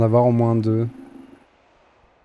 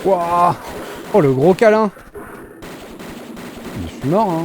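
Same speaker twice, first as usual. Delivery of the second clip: panicking gamer; 6 semitones higher than usual. Real, not acted. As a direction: yelp as enemies swarm you. Gamer attacked and dies.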